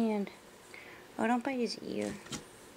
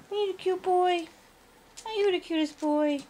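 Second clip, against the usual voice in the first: high, sing-song cooing at the puppy; doting puppy talk, pitched up; 8 semitones higher than usual. Real, not acted.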